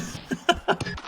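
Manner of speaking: brief amused chuckle; quick breathy chuckle; short laugh. Real, not acted. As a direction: laugh lightly after the call. Amused by shot going in.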